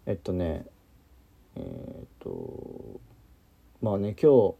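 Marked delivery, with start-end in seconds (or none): 1.6-3.0 bad connection. Real, not acted. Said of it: "途切れ途切れになってるかな".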